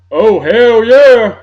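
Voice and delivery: loud, slow drawn-out hell yeah; wrestler catchphrase. Retold or imitated. Hyping up Stone Cold for his buddy, loud and slow. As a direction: bellow it like a ring promo.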